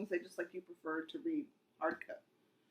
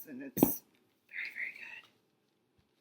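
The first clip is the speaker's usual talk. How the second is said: slow hushed whisper; much slower than usual. Real, not acted. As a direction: whisper it slowly like a secret. Whispered praise of series.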